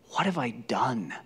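slow, hushed near-whisper of despair; broken. Acted. Peter after denying his Lord, broken and hushed. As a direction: breathe it out, crushed. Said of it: "He's broken".

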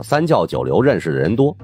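ordinary delivery

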